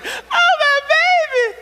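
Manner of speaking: high wailing cry, far above his voice; hammed-up crying scream of a mother; mock wailing mother. Mother on the news wailing over her son. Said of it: "she screams with emotion".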